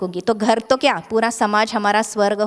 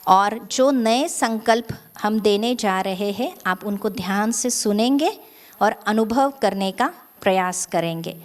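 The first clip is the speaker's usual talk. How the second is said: ordinary delivery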